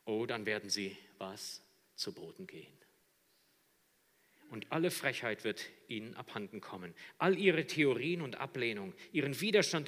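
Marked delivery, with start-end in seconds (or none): none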